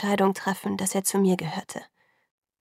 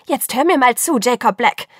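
angry scolding; 3 semitones higher, 8 dB louder than usual. Acted. Bella scolding Jacob.

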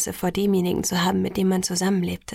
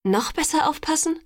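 high-pitched disbelieving question; incredulous; 7 semitones higher than usual. A narrator's reading. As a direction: echo it back in disbelief. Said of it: "sagte ich ungläubig". (ungläubig nachgefragt)

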